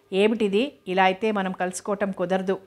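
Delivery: plain reading voice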